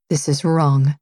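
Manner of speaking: ordinary delivery, nothing marked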